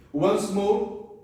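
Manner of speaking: ordinary talk, nothing marked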